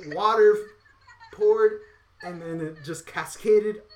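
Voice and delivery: loud, high burst on the payoff; water poured, shouted high with glee; excited punchline. Real, not acted. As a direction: blurt it out like the big reveal. Punchline of the sweat story.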